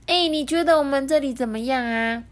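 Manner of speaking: high-pitched, keen penguin asking; eager question. A narrator's reading. Penguin asks monkey's opinion of home, eagerly. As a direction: ask impatiently, pitched high. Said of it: "就急着问飞普说".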